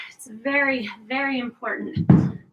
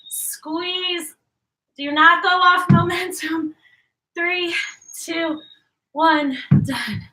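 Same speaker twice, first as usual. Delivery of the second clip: straining; 4 semitones higher, 4 dB louder, slower than usual. Real, not acted. Straining through reps.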